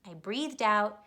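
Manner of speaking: ordinary delivery, nothing marked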